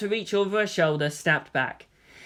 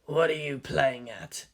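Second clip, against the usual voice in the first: through his teeth; 4 semitones lower, 5 dB quieter than usual. A narrator's reading. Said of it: "Kaji asked through his teeth".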